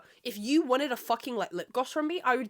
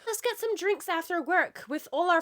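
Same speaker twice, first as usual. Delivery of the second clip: mimicking; 7 semitones higher than usual. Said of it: "being like".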